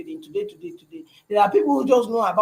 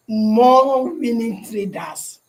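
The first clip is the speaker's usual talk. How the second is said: slow, weighty stress on more winning traders; drawn-out emphasis; 3 semitones higher, 4 dB louder, much slower than usual. Real, not acted. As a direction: lean hard on every word.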